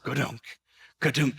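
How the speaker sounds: a clumsy gadunk gadunk, like a running sheep; goofy mimicked sheep noise; sheep bleat imitation. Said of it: "the sheep was like".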